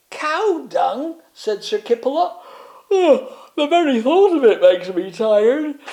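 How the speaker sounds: weary disgust; dozy knight. Acted. Kipperlot appalled at the plan, high and loud.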